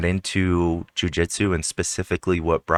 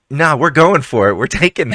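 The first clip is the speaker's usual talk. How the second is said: mock bold attitude; 9 semitones higher, 8 dB louder than usual. Host voices her bold attitude.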